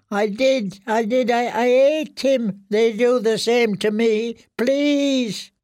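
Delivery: high, stammering confession; frightened pleading. Acted. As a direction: drag out the words in a very high, begging voice. Prisoner confesses and begs.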